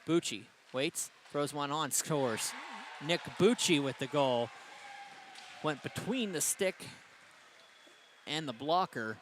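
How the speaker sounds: a commentator erupting as the puck goes in; excited goal call. Real, not acted. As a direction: sell the goal to the crowd. Goal call in hockey play-by-play.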